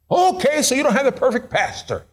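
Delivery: high, teasing okay; joking shrug. Acted. Pastor joking to the congregation about himself, high teasing. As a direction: shrug it off with a wink.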